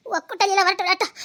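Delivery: usual speaking voice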